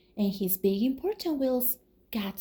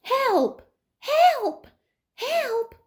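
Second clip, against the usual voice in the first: high, loud calls for help; cries for help; 14 semitones higher, 5 dB louder, much slower than usual. Acted. Reading to kids, Little Blue calling for help. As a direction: call out as if stuck in mud.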